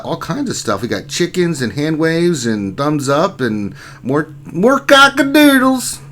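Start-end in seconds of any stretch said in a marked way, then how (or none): 4.4-6.0 much higher, slow crowing cockadoodles; rooster crow. Pretend. Streamer listing chicken emotes, crowing like a rooster.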